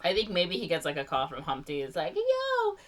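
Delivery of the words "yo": high, far falsetto egg voice. Retold or imitated. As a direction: squeak it like a silly egg. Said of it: "he doesn't talk like that".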